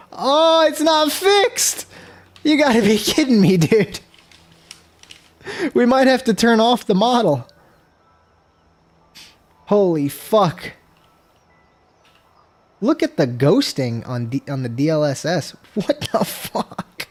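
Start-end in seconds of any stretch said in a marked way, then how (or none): 0.1-4.0 exasperated. Real, not acted. Game bug not fixed. 15.7-17.1 disbelieving whisper. Real, not acted. Seeing bad ghosting.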